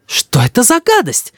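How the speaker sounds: high-pitched outcry without real volume; mother's cry. A narrator's reading. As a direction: exclaim in disgust as the mother. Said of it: "закричала мама".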